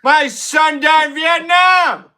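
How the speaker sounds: hammed-up Biden slipping up; mock Biden shouting his blunder; Biden impression. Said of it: "he'd come out and be like".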